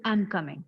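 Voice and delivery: low, soft "I'm coming", I'm merged; natural contraction. Shown as a demonstration. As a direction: reduce "I'm" to one weak beat before COMing. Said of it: "see how you pronounce it".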